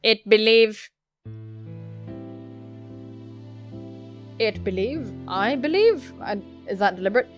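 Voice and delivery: even, monotone robot voice; level-pitched android delivery, sliding up on odd words; stilted android. Acted. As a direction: speak mechanically, every word measured.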